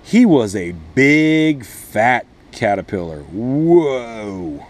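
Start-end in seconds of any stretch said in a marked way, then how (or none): none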